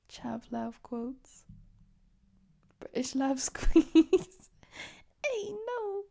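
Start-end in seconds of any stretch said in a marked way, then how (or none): 5.2-6.0 high, fast pleading; mock panic. Pleading with Connor not to rob him, panicked and high. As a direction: beg them to stop, way up high. Pleading against being robbed.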